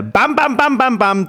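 rapid high bams; high comic impact noises; mock punches. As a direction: fire off the bams high-pitched. Acting out his imagined reaction.

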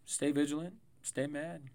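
ordinary delivery

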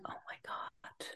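hushed, breathless gasp; whispered realization. Retold or imitated. Realizing her son is just exhausted, whispered. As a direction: whisper it as the penny drops.

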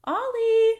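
high sing-song voice to the cat. Real, not acted. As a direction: gently scold the kitty, high. Talking to the cat.

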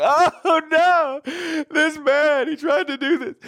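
high, loud put-on distress; fake distress. Wife faking tears before the guards, high loud fake distress. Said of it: "make it look like".

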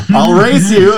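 a loud, high-pitched, eager "I'll race you"; a kid eager to race to the shower; excited challenge. Retold or imitated.

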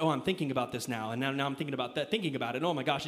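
acting out an anxious thought loop; mock anxious spiral. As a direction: spiral in your own head.

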